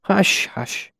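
high, tender hushing; patient, gentle murmur. A narrator's reading. Trappist nursing a feverish child, patient gentle murmur. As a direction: murmur soothingly, letting the hushes fade. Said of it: "murmuring his patient, gentle".

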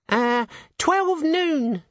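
very high, slow squeaky imp; tiny creature voice, drawn out. Acted. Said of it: "said the imp".

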